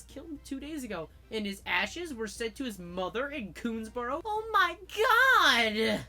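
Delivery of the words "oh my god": drawn-out shocked cry; slow, loud gasped outburst; shocked shout. Acted. Mock shock at the news.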